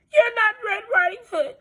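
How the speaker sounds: high, quavering granny voice, alarmed; an old lady catching the trick; alarmed grandmother. Acted. Grandmother alarmed at the wolf in the doorway.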